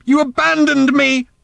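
high, loud accusing scream; furious shout. A narrator's reading. Random accusing her mother, shouting furiously. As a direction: scream the accusation at full pitch. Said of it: "shouted Random".

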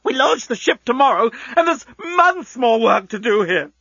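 put-on high-pitched ranting old man; outraged berating. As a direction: drag out the complaint in rising panic. Outraged boss berating shifty worker.

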